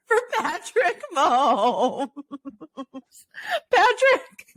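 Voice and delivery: can barely get the name out for laughing; squealing with laughter on the name. Real, not acted. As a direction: voice climbing high through giggles. Laughing at a mix-up.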